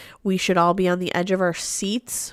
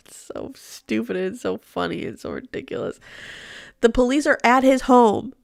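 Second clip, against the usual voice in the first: ordinary talk, nothing marked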